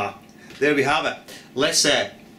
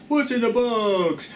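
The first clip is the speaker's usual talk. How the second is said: slow, higher, hammed-up movie line; dramatic movie quote; 7 semitones higher, slower than usual. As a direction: drag it out like a thriller. Quoting film line.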